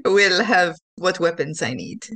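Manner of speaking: ordinary delivery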